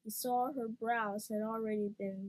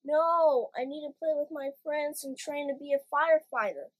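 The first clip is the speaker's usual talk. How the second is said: put-on high, loud, fast pleading child; 5 semitones higher, 8 dB louder than usual. A narrator's reading. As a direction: beg loudly, like a kid refusing. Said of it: "Poopskin pleaded".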